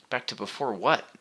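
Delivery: insistent, pressing question; demanding. A narrator's reading. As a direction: demand an answer. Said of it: "Rainier demanded to know".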